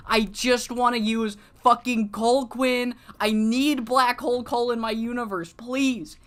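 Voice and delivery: loud, high-pitched begging; exasperated fan plea. Retold or imitated. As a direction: plead at full voice, worked up. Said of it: "And I'm like".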